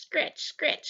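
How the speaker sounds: plain reading voice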